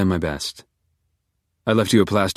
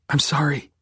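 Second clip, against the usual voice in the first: high, hoarse apology; 8 semitones higher than usual. Acted. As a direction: rasp out the apology. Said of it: "You are hoarse".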